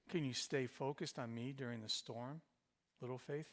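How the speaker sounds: quiet, tender question; gentle pulpit appeal; soft plea. Acted. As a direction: hush it down, pleading. Soft voiced plea of Jesus.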